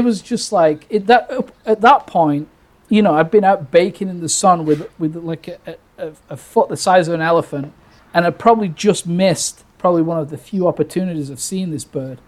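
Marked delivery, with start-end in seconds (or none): none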